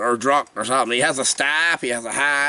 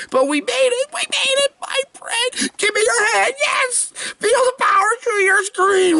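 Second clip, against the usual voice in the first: mock triumphant squeal.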